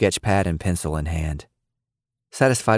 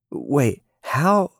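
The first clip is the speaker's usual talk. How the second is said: a stunned stutter, "how" jumping much higher; 8 semitones higher than usual. Acted. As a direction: caught off guard by the triple jump, sputtering. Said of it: "she stuttered".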